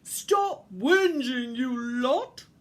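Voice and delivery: loud, slow, big-voiced order; booming Santa. A narrator's reading. Santa scolds the complaining reindeer, booming big voice. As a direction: boom it out slowly like Santa. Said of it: "boomed out Santa's big voice".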